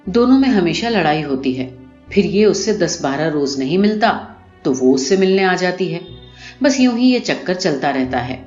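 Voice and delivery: usual speaking voice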